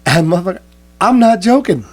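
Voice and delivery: imitating a joke-teller. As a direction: ham up the guy's deadpan insistence. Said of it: "He goes".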